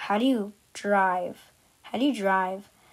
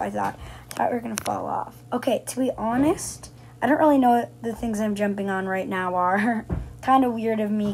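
ordinary talk, nothing marked

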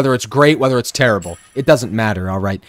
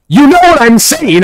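high, loud mock outburst; exasperated retort; 9 semitones higher, 7 dB louder than usual. Streamer answering viewers who correct his pronunciation, exasperated. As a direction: protest loudly at the nitpicking, pitch pushed up. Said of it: "I'm like".

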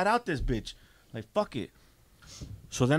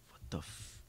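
whispered, cut-off disbelief; stunned whisper; 19 dB quieter than usual. Retold or imitated. Teenager left alone at the Greyhound, stunned whisper. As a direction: let it slip out voiceless and unfinished.

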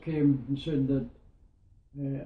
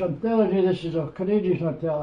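raised, higher retold insistence; sergeant insisting; 5 semitones higher, 6 dB louder than usual. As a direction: push back hard as the sergeant, louder.

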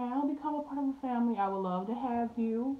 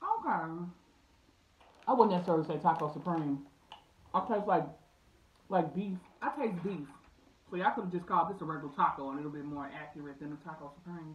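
cracking seeds between words; talking while chewing seeds; 6 semitones lower, 4 dB quieter, faster than usual. Real, not acted. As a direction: mouth partly full of seeds. Seen 0: handful popped at -9; hand to mouth at 2.6–3.2, mouth working.

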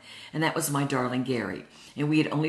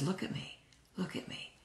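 near-whisper, very soft; real quietly; 4 semitones higher, 12 dB quieter than usual. Retold or imitated. Husband gently coaxing his tearful wife to look at him, real quietly. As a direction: say it hushed, almost all breath. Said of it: "real quietly".

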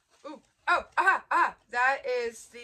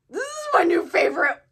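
higher and louder, bursting with delight; excited; 8 semitones higher, 13 dB louder than usual. Real, not acted. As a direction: exclaim it with thrilled emphasis. Gushing over a gift.

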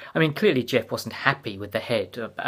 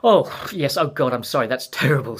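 high, loud, flustered apology; 4 semitones higher, 10 dB louder than usual. A narrator's reading. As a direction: caught out and rushing to apologise. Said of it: "blushing a little and flustered".

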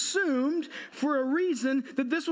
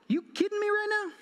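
high, stunned disbelief; higher unbelieving father; incredulous; 9 semitones higher than usual. Real, not acted. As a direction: throw it up high in shock. Dad's stunned reply to daughter.

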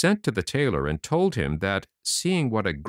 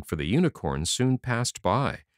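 usual speaking voice

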